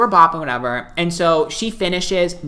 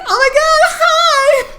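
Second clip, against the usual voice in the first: very high, loud squealed hello; excited greeting; 6 dB louder, slower than usual. Retold or imitated. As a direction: imitate a star thrilled to be recognised. Star greets fan delightedly.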